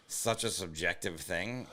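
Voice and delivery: ordinary talk, nothing marked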